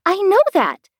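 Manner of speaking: put-on high, eager girl's cry; a keen child bursting to answer; eager high exclamation. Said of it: "Alice cried eagerly".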